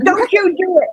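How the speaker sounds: high-pitched mock warning at a bug; mild mock yell, high; retold yelling. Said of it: "What are you yelling at?".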